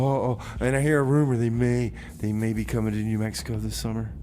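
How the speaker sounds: normal talking voice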